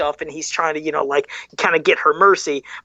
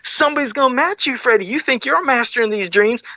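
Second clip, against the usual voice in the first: higher, excited retelling of a fan's thoughts; eager mock challenge, pitched up; 6 semitones higher than usual. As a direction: relive the thrill of a challenger appearing. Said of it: "we were thinking".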